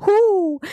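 high, sustained owl hoot; high-pitched imitated hoot.

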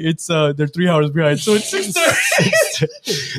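giggling through a retelling; laughing. Real, not acted. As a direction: laugh while mimicking the mixup. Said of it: "That was fun".

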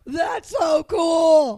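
loud, drawn-out, voice-straining shout; strained shout. Real, not acted. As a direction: yell it slowly until your voice gives. I just broke your speakers and I have no voice.

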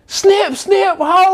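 high yelled catchphrase, far from own voice; shouted ad lib. Retold or imitated. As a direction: belt the ad lib like the Vine guy. Made the ad lib so popular.

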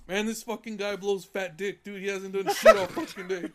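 griping to the foreman about a lazy coworker, loud. Retold or imitated. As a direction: vent it loudly to the boss. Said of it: "that's how I talk to him".

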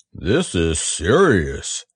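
low, slow bear voice; a slow, solemn bear of little brain; Pooh voice. A narrator's reading. Pooh realising the flood.

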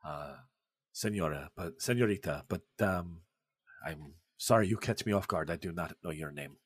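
put-on a gracious gentleman with a soft foreign accent, caught off guard; Pessoa's accent. Poet greeting the admiring women, soft foreign-accented courtesy. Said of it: "shocked to hear another accent that isn't Russian".

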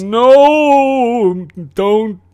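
high, loud ghostly warning; slow, looming spirit voice. Acted. Future self warns ominously.

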